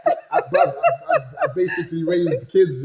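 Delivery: stumbling through a laugh. Real, not acted. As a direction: laugh while getting the words out. Laughing reply about raising kids.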